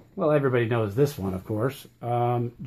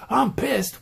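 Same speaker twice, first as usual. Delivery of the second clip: a higher, mock-angry teen outburst; 8 semitones higher than usual. Shown as a demonstration. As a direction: spit it out like a sulky teen. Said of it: "But if you go".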